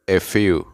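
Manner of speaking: "if you" linked as one word; words joined. Shown as a demonstration. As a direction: glide from "if" straight into "you". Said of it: "se unen y se pronuncia". ("if you" pronunciado todo unido)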